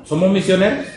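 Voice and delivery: usual speaking voice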